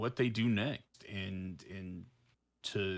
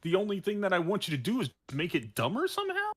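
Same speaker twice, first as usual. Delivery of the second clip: higher, laughing, gushing over a dumb movie; hammed-up delight, giggling through it; giddy fan excitement; 10 semitones higher, 5 dB louder than usual. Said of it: "I'm like".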